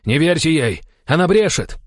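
high, desperate yelled denial; shouted. A narrator's reading. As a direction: shout to the men to disbelieve it. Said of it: "закричал он". (отчаянный крик)

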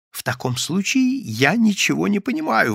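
baffled exclamation, pitched high; exclaiming. A narrator's reading. Yevsey cannot understand the invisible man's denial, exclaiming. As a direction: exclaim in bewilderment without shouting. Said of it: "воскликнул Евсей Львович".

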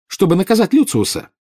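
high, loud anguished outburst; insistent. A narrator's reading. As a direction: press the accusation home, voice way up. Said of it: "настойчиво повторила она". (настойчиво, с надрывом)

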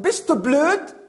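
high, loud challenge; annoyed shout. Acted. Hunter fed up with the voice, angry shout. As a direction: snap it out at the unseen voice. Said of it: "Irgendwann ist er so sauer".

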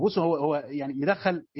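ordinary delivery

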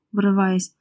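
plain reading voice

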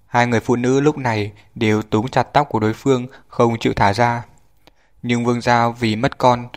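plain reading voice

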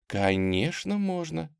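gentle, soft answer rising high; soft reassurance. A narrator's reading. Tamara reassuring the thin girl, soft and gentle. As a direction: reassure softly. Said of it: "мягко ответила Тамара".

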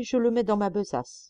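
ordinary delivery

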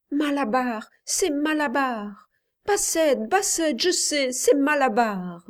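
high, urgent feverish calling; feverish raving. A narrator's reading. Sick boy in fever, raving the horse's name. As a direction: rave it out in delirium. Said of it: "Il parlait et s'agitait sans cesse".

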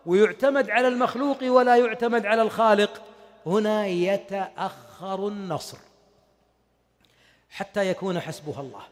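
ordinary talk

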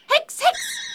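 high, excited cry of the spell; magic words. Acted. Girl calls out her spell's final words, high and excited.

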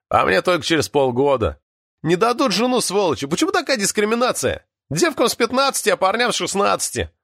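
high-pitched indignant grumbling; upset complaint. A narrator's reading. Alyokha moans about marriage age limits, upset and indignant. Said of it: "огорчился Алёха".

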